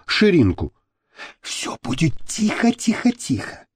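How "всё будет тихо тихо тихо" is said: soft, higher, breath-only whisper; whispered reassurance. A narrator's reading. Miriam assuring her lover of silence, whispering. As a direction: whisper to soothe and coax. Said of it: "заверила его Мириам".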